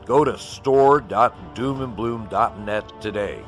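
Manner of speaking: ordinary talk, nothing marked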